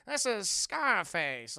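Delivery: hammed-up gangster drawl; tough-guy introduction. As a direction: drag out the name like a movie thug. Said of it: "tough guys' names".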